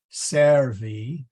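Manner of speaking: teacher's loud demo of "servi", accent up front; stress on first syllable. Shown as a demonstration. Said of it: "accent location does not change".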